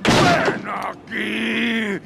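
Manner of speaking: slow, drawn-out angry shout; furious shout. Acted. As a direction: bellow his name in rage.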